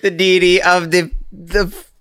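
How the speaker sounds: breaking into laughter mid-sentence; laughing. Real, not acted. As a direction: laugh through the words. Stalling answer dissolving into laughter.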